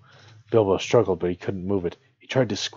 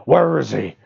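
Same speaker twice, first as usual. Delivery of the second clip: high, loud goblin shout; goblin cry; 5 semitones higher, 7 dB louder than usual. Acted. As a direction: yell it like a frantic goblin. Goblins crying out in story.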